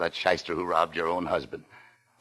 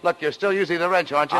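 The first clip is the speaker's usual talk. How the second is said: raised, much higher accusing voice; angry demand; 9 semitones higher than usual. Acted. Argument with boss over money.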